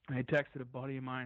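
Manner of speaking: usual speaking voice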